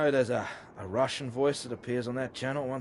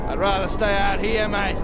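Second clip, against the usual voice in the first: very high, loud boozy holler; loud merry call from far away; tipsy calling out; 9 semitones higher, 9 dB louder than usual. Acted. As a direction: shout it back across the distance, half drunk.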